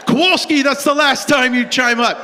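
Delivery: loud high mock coach scolding; coach snapping. Coach shouting down Kowalski, loud. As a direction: bark at the player who spoke up. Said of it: "coach scolding player".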